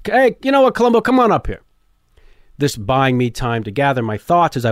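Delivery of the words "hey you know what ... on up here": much higher, showman calling someone up on stage; hammed-up emcee inviting the kid up; Rich Little's call. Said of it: "he takes the mic back and says".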